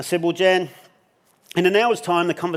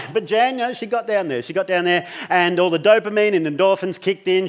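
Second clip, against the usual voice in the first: ordinary talk, nothing marked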